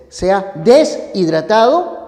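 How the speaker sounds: "deshidratado" partido tras la s, des-hidratado; error de prosodia. Shown as a demonstration. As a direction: di "des", pausa breve, "hidratado". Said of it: "cometes un error de prosodia". ("deshidratado" con corte erróneo, des / hidratado)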